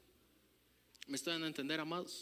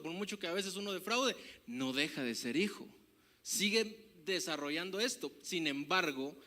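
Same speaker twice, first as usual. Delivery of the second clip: ordinary talk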